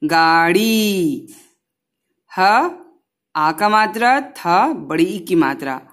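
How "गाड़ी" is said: गाड़ी with a long, slow drawn-out ई at the end; long drawn vowel. Shown as a demonstration. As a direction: stretch the final ई of गाड़ी. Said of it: "लंबा है आवाज".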